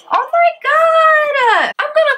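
drawn-out, high excited squeal; real delighted scream at the gift; shrieking with joy, slowly. Real, not acted. Opening a surprise gift box.

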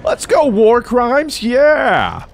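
high, drawn-out victory whoop; triumphant cheer. Real, not acted. Celebrates a double kill.

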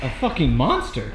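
ordinary talk, nothing marked

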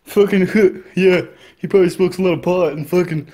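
mocking stoner coworker impression; lightly voiced slacker with chuckles breaking in; imitated background guy. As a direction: imitate a pot-smoking coworker chiming in. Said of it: "That's what he sounded like in the back".

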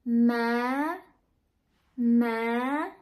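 "ma" sweeping upward at the end; third Vietnamese tone. Shown as a demonstration. Teacher showing students the third tone, rising. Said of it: "The third".

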